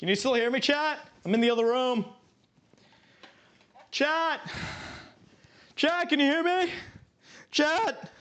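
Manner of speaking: shouting from the next room; from another room. Real, not acted. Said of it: "see if chat heard me screaming".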